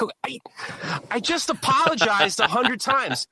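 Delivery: high-pitched, incredulous outburst; exasperated. Retold or imitated. Man answers neighbor who rejects his apology, exasperated. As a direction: burst out as if at wit's end.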